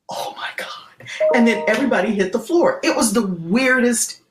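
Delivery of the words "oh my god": breathless unvoiced gasp of fear; whispered horror; shocked whisper. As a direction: barely breathe the words. Panic on the bank floor.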